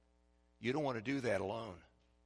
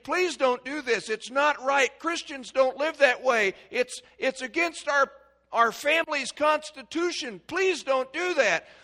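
pleading parent; 12 semitones higher, 9 dB louder than usual. Retold or imitated. Pleading with his children.

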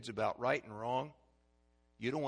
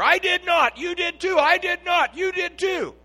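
high, loud squabbling back and forth; hammed-up tit-for-tat yelling; mock quarrel; 14 dB louder than usual. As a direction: shout it like two kids bickering. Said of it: "it's yakking at each other".

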